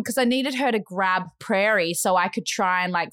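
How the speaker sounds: plain reading voice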